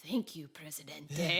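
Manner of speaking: breathy whisper of a game aide. Retold or imitated. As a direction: whisper it like an overheard advisor. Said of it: "overhear just, like".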